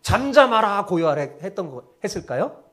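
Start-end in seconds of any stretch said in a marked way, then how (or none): 0.0-1.2 raised-voice order, easing off at the end; a brief re-enacted rebuke to the sea; rebuking command. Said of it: "바다에게 지금 꾸짖는 거예요?". (바다를 꾸짖듯 목소리 높여 명령)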